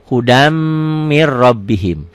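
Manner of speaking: "Hudam" hummed on a held m, then doubled r in "mir rabbihim"; idgham reading. Shown as a demonstration. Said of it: "di atas mimnya ada tasdidnya". ("Hudam mir rabbihim", m ditahan, r ditekan ganda)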